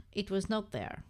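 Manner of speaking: ordinary talk, nothing marked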